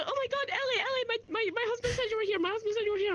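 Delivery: high excited woman gushing, near tears; excited wife. Retold or imitated. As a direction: gush like a thrilled fan's wife. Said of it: "she's, like, almost crying".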